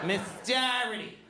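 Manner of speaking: Boston accent, imitating the teacher. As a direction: say it Boston style. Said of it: "She mispronounced her own name".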